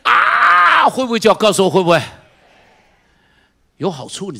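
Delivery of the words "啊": much higher, loud shouted ah; yelled ah rising high; roller coaster scream. Shown as a demonstration. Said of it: "云霄飞车在那里飞的时候".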